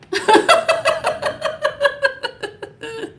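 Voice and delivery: long high-pitched cackle; helpless high giggling fit; long laugh. Real, not acted. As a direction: laugh in a rapid, high staccato run that tails off. Laughs after her own joke.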